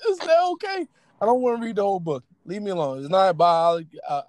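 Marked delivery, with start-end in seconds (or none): 0.1-0.9 high playful question. Real, not acted. Repeat after laugh.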